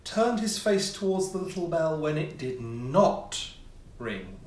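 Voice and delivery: ordinary delivery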